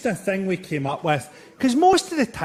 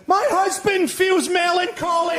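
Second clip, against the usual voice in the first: mock heckle shout; 11 semitones higher than usual. Imagined Hamlet audience member shouting a heckle, hammed-up. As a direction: bellow it across a theatre at Hamlet.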